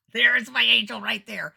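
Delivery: slow, high, loud exclamation with a laugh; loud jubilation. Retold or imitated. Entertainer hailing her prayed-for helper, loudly jubilant. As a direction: proclaim it in comic triumph. Exultant repeat after spotting helper.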